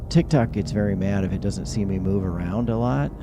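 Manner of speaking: ordinary talk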